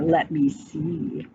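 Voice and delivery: a greedy bear asks about dessert, low and slow; big bear. A narrator's reading. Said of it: "said the bear".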